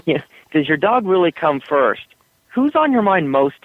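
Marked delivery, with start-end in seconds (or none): none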